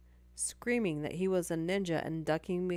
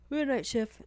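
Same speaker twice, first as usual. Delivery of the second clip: yawning through the words; words stretched by a yawn; 7 semitones higher, 6 dB louder than usual. Real, not acted. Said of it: "I'm yawning a yacht".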